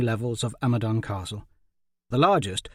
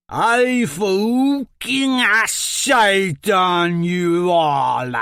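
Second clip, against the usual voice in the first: very slow, louder, higher brutish roar; ogre bellow; 6 semitones higher, 6 dB louder, much slower than usual. A narrator's reading. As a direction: roar it at the booing crowd like a beast. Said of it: "bellowed in his thick Ogul accent".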